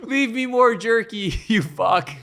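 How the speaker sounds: high, loud, laughing through it; the dog demands jerky, cracking up. Pretend.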